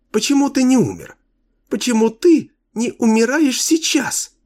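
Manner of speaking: put-on very high childlike taunt; gleeful cruel little girl voice; cartoon princess.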